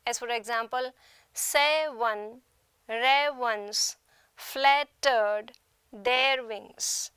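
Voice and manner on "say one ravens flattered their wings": "say one, ravens flattered their wings" slowly, in separate two-syllable feet; trochaic scansion. Shown as a demonstration. Teacher illustrating trochaic meter, slow chopped scansion. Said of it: "Trochaic meter has the first syllable stressed".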